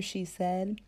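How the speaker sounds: usual speaking voice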